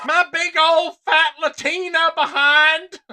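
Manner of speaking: loud, high, slow Southern parody. Retold or imitated. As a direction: holler it in a hammed-up Southern drawl. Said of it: "A O C doing the same thing".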